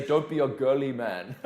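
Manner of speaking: terrible mock Austrian accent; hammed-up Austrian accent, played for a laugh; badly faked Austrian accent; Schwarzenegger. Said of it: "a terrible accent".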